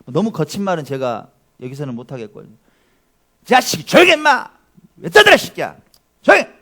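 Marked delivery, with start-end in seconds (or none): 3.4-6.5 pastor yells like a rough crowd, much louder and higher; rough crowd yelling. Pretend. Preacher acts out the crowd yelling at the blind man, a loud mock shout. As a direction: bark it out like a mob scolding a beggar. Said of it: "뭐 이 정도는 했지 않겠어요?".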